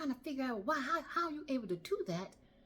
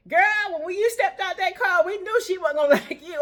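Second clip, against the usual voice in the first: put-on loud, knowing girlfriends' shout; loud gossip; 8 semitones higher, 12 dB louder than usual. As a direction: holler it like the whole team knew. Ladies calling out the gossip.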